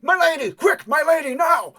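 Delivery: very high, loud shouted prompt; urgent yell. Acted. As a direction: shout urgently for her to act. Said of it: "he hears yelled coordinations".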